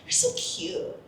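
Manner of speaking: very soft, half-voiced imitation of his pet phrase; mimicked gushing compliment. Cohost mocking Pepe's favourite word, mimicked soft gushing. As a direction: mimic a cooing, near-whispered compliment. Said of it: "so tired of Pepe saying cute".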